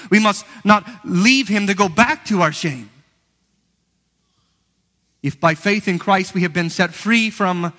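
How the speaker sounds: fervent pulpit exhortation; full-force preaching. Acted. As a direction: drive the point home from the pulpit.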